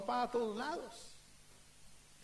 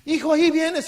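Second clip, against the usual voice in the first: louder, strained call over the wind; exhausted father calls to his son, much louder; tired father calling out; 5 semitones higher, 11 dB louder than usual. Acted. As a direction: shout back without turning, worn out. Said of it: "él ya muy cansado".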